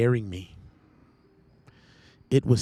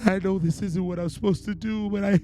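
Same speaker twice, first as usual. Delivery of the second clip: very high, anguished plea to God; desperate prayer; 10 semitones higher than usual. Retold or imitated. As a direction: relive begging for help, overcome by fear. Reliving a desperate prayer, high and strained.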